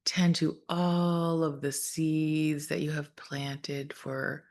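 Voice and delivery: calm, measured meditation voice with long pauses; guided meditation. Real, not acted. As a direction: gently guide listeners through breath and imagery, unhurried.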